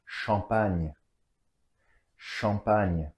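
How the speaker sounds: "Champagne" with a nasal first vowel and gn as ñ; French pronunciation. Shown as a demonstration. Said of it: "A and M together is the nasal vowel".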